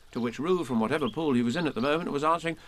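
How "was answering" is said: ordinary delivery, nothing marked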